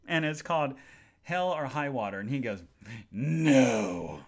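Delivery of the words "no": loud, low curt-mannered no; rude refusal. Retold or imitated. Man refusing a stranger's friendly question, rude. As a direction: imitate a rude man shutting someone down. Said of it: "Really rude".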